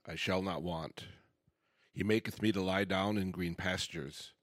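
normal talking voice

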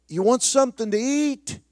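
host calling a guest from another room. Retold or imitated. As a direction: call his name across a big house. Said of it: "he yells through the house".

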